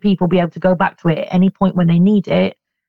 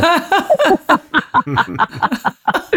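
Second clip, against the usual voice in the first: witch laugh; 9 semitones higher than usual. Real, not acted. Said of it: "my natural laugh is a very witch laugh".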